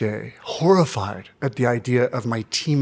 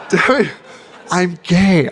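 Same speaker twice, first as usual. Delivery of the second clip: louder, laughing through it; laughing repeat; 5 semitones higher, 7 dB louder than usual. Retold or imitated. Retelling repeating it to his coach, laughing. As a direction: say it again while laughing.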